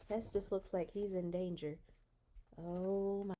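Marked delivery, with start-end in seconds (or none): none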